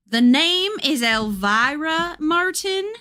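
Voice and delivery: a lightly voiced stern ghost; sharp ghost voice. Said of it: "the voice says sharply".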